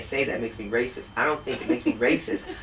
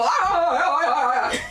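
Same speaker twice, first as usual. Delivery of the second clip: mock wailing cries; 12 semitones higher, 5 dB louder than usual. Mocking a man's pain.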